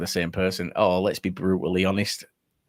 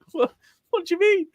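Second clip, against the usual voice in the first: much higher stunned question; shocked; 6 dB louder than usual. Retold or imitated. Player stunned by the manager's verdict, high shock. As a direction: caught completely off guard. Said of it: "And he was like".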